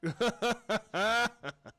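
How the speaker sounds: high, creepy drawn-out cackle; serial killer laugh. Acted. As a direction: laugh like a mad scientist. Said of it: "was that your serial killer laugh?".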